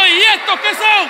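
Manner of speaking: loud, high, slow gloating question; triumphant shout. Retold or imitated. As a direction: slam down the proof, triumphant. Esposa encuentra los espejuelos. (grito triunfal de la esposa)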